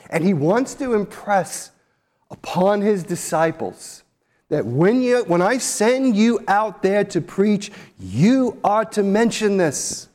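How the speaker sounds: normal talking voice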